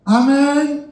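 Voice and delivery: high, loud, drawn-out amen question; prompting amen. Real, not acted. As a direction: pull an amen from the crowd. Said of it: "prompts congregation's amen". (seruan amin yang tinggi dan keras)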